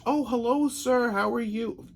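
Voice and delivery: lightly mocking sweet lady, high; sugary shop owner. Mocking the shop owner's sweet welcome, high and sugary. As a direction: play her suddenly sweet greeting.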